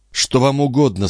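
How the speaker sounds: a hint of a lady challenging an intruder; lightly voiced startled lady. Героиня допрашивает незваного гостя. (слегка обозначенный голос встревоженной дамы)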